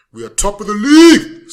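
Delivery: gleeful, loud cheer; excited shout. Real, not acted. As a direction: shout it out in triumph. Celebrating top of the league.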